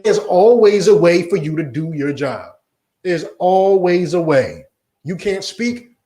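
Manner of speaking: ordinary delivery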